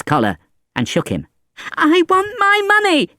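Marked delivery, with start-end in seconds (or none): 1.8-3.1 put-on high, loud child's demand; crying out. A narrator's reading. Boy shaking Valjean's collar, crying out. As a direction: cry out like a furious little boy. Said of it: "he cried".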